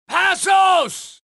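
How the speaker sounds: loud, high shout across the room; shouting a name. Acted. Calling out for his missing partner, loud and high. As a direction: yell for him to answer.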